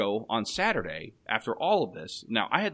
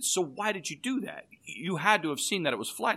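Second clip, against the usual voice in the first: incredulous; 7 semitones higher than usual. Retold or imitated. Confronting the mechanic.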